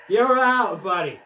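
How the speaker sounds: loud, high, triumphant call; umpire-style call. Pretend. Player calls the batter out, gloating shout. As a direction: holler it like an umpire.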